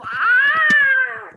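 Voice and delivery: high, loud cry of pain; pained scream. Acted. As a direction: yelp as the fingers snap. Scream as fingers are broken.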